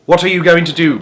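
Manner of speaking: put-on boy calling out in worry; mild alarmed cry. A narrator's reading. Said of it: "cried Charlie".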